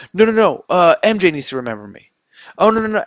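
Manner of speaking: high, hurried mock panic; frantic interrupting. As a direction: cut in urgently, acting flustered. Peter frantically interrupts the spell.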